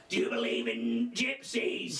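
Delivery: slow, slurring fortune teller voice; slurred words. Retold or imitated. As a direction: drag and slur every word. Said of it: "slurring the words".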